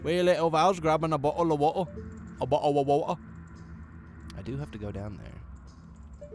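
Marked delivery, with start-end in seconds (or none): 0.0-3.2 teasing, high British "bottle of water"; mock British accent. Teasing his British friend, exaggerated British accent. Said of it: "live stream suddenly got a lot less British".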